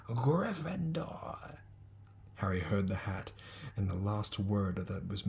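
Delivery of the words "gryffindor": hat announces. Acted.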